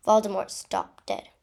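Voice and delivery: usual speaking voice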